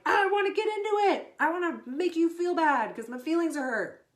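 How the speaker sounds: hammed-up angry self; mock heated wife. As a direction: whine it like a hurt spouse. Self voiced in argument.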